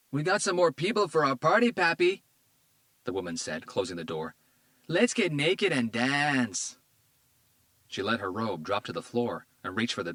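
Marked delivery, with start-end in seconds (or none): 0.1-2.2 much higher, louder gleeful call; stoned woman calling to her man; excited call. A narrator's reading. 4.9-6.8 very slow, higher seductive drawl; stoned woman seducing detectives; slow come-on. A narrator's reading. Woman flirting with cops.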